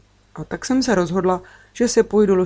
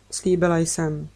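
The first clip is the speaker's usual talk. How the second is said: ordinary delivery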